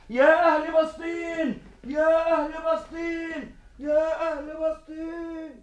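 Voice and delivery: long drawn-out rallying call; slow calling out. Acted. Calling out to the people. (نداء بطيء ممدود)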